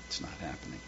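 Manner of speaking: a hushed whisper, fading away; despairing whisper; whispered lament. Acted. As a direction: let it die to a whisper. Repeated, now whispered.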